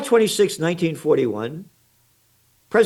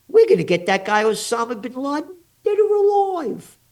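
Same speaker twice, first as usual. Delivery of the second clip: mocking George W. Bush; 6 semitones higher than usual. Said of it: "Little Georgie Bush".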